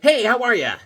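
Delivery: high, loud cheery toy voice; happy greeting. Pretend. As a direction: greet brightly as a happy action figure. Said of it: "He's happy".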